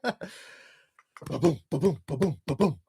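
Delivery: soft mimicked heartbeat thumps; imitated heartbeat. Shown as a demonstration. Fan showing his racing heart during the drive, soft heartbeat imitation. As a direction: sound out your pounding heart, quietly. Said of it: "it is pumping so hard right now".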